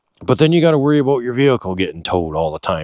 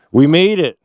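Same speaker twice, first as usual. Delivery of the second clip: louder, higher burst of triumph; triumphant; 5 semitones higher, 5 dB louder than usual. Real, not acted. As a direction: exclaim it in one quick celebratory burst. First cry of arrival.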